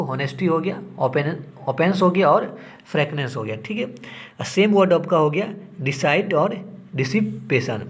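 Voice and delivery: ordinary delivery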